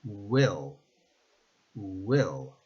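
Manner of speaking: Will begun with a Spanish U glide, "U-il"; Spanish U start. Shown as a demonstration. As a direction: open Will with a U vowel. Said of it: "replace it in your mind with the Spanish letter U".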